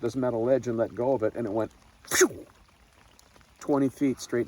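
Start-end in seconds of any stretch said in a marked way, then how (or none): 2.1-2.4 falling whoosh. Retold or imitated. Phone falling into river.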